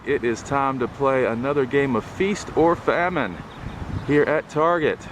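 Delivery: ordinary talk, nothing marked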